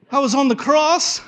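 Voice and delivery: high, loud comic protest; mock protest. Thief explaining himself to the angel, high mock protest.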